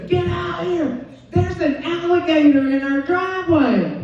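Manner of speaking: high excited yell to come outside; urgent husband shouting for her; husband calling out. Retold or imitated. Husband calling wife outside, high and excited. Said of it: "And he goes".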